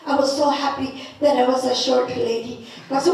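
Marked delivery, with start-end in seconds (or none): none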